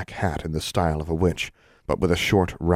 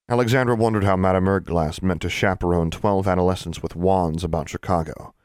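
usual speaking voice

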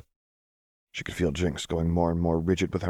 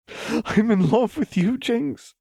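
much higher and louder, slow and overjoyed; cried out; 6 dB louder, slower than usual. A narrator's reading. Lux declaring love to Jinx, crying out. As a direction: cry it out to the heavens. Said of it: "Lux cried".

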